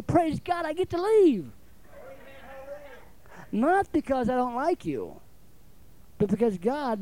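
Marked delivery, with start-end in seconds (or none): 0.0-1.5 high, bright burst of joy; joyful praise. Retold or imitated. Preacher called away, rejoicing instead of mourning. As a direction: celebrate getting to leave. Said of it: "I'm gonna say".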